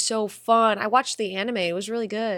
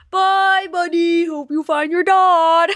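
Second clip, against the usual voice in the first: loud, high, slow silly goodbye; high, loud, drawn-out mock farewell; hammed-up farewell; 9 semitones higher, 12 dB louder, slower than usual. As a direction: belt out a comic send-off. Sung-out goodbye.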